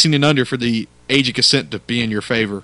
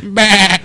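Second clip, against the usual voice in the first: goat bleat; 8 semitones higher than usual. Retold or imitated. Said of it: "That sounds like a goat".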